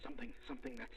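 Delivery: muttered whisper. Acted. Thinking aloud quietly.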